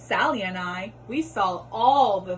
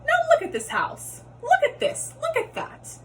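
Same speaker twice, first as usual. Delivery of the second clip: high, outraged squeal; fish scolding. Acted. Fish shows the cat the wrecked house, high outraged scolding. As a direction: point at every mess in alarm.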